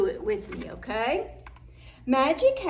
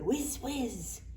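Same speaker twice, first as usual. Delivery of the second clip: ordinary delivery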